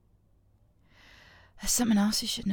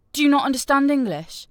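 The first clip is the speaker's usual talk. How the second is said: angry snap; 6 semitones higher, 7 dB louder, faster than usual. Acted. Angry question.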